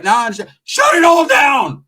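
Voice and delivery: high, slow shouted boast; loud drawn-out proud shout; shouting the brag. Real, not acted. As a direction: bellow it out slowly.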